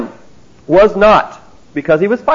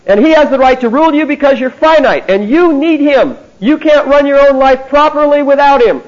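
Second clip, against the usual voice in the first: higher and louder, like a preacher pressing the crowd; forceful street preaching; 7 semitones higher, 4 dB louder than usual. Retold or imitated. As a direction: tell strangers outright they can't run their lives. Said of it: "When she started saying".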